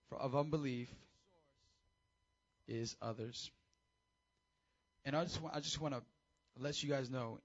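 normal talking voice

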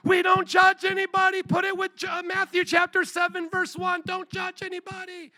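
high, squealy parody of an eager believer; mocking excited churchgoer. Pastor mocks congregants who quote their favorite verse, high and gushing. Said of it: "gets excited and goes".

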